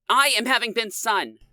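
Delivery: high sudden outburst; burst out. A narrator's reading. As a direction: blurt it out. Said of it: "Yasmin burst out".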